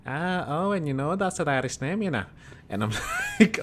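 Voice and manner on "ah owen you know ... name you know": higher-pitched mock Irish accent; comic imitation of an Irish pub regular. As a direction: play a chatty Irishman, pitched up. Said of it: "the Irish guy is like".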